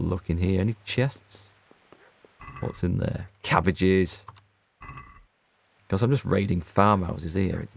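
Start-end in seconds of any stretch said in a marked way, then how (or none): none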